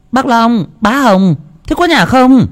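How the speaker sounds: high, anxious call to the house; calling from outside. A narrator's reading. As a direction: shout for the neighbours from the lane. Frantic neighbour calling from gate.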